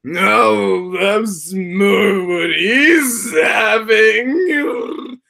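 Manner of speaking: high, very slow drawn-out cartoon voice; character voice. Streamer doing Knife's drawn-out voice for chat, high and slow. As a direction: stretch every word out like a toon. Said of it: "very extended means of vocalizing".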